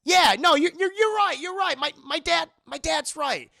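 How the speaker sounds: high, loud, pumped up; rallying himself with energy; fired-up Max. Retold or imitated. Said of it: "he's like".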